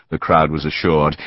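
ordinary delivery, nothing marked

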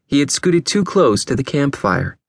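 ordinary delivery, nothing marked

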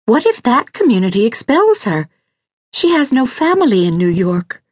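high, anxious woman's voice; distressed wife. Acted. Lydia fears Mouse will be expelled, distressed.

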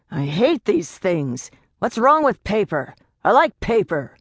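irate complaint. A narrator's reading. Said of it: "an irate man".

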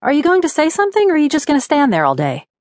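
exasperated rapid challenge; impatient snapping. A narrator's reading. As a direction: rattle it off, fed up. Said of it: "snapped Brenda".

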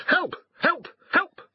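very high-pitched dog squeal; short, panicked yelps from a talking dog; crying for help. A narrator's reading. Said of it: "cried Garm".

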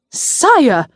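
high, loud indignant protest; indignant cry. A narrator's reading. Ari protests being dismissed.

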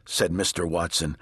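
plain reading voice

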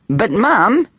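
put-on high, whiny child's plea; protesting. A narrator's reading. Boy objecting to his mother, protesting. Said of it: "he protested".

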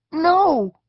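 very high, loud cry; desperate cry. A narrator's reading. Eric as steps disappear under him, desperate loud cry. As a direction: cry out as the ground gives way. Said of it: "he cried".

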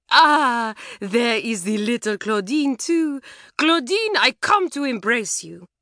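high, excited French call up to a window; high, doting French call; gushing French aunt. A narrator's reading. Mamselle voiced calling up.

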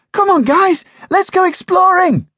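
high, loud eager puppy voice; excited puppy. Acted. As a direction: bounce with eagerness to go exploring. Excited puppy Bounce calling.

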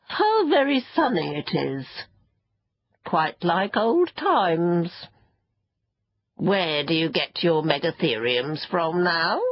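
put-on leisurely old creature, savouring the words; contented sand fairy. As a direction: reminisce with slow, pleased relish.

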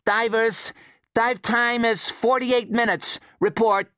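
crisp high radio voice; radio call. Acted.

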